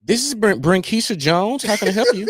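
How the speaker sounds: mock the polished call center greeting.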